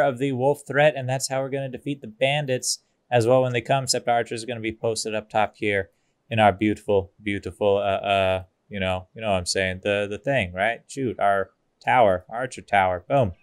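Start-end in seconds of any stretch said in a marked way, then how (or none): none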